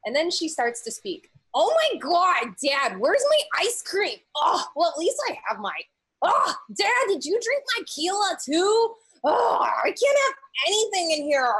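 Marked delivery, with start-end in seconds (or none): 1.5-11.5 put-on loud, high, whiny ogre girl yelling; yelling across the room. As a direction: shout it at Dad from the kitchen. Ogre daughter yells at dad.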